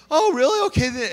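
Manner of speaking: very high "Oh, really?" then settling; surprised relief. Retold or imitated. Wife hearing the camera can't see the screen, surprised and relieved. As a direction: caught off guard, then relieved.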